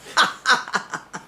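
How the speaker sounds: a light airy laugh at her own joke; high breathy laugh. Real, not acted. Laughing at her own joke about Desi, airy.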